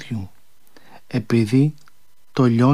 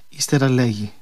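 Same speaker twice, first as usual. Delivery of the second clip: ordinary delivery, nothing marked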